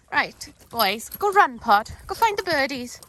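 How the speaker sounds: loud, high urging to the dogs; excited calling. Real, not acted. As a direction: send the dogs off, bright and loud. Sending dogs off.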